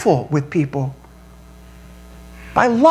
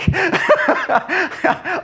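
amused laugh; 10 semitones higher than usual. Real, not acted. Laughing at own hike story.

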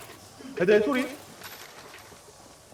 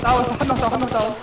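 excited callout; 6 semitones higher, 5 dB louder than usual. Real, not acted. Teammate down callout in firefight.

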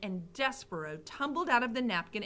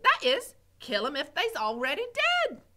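simple Mig voice; 5 semitones higher, 6 dB louder than usual. Acted. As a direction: admire the big word dimly. Mig repeats cook.

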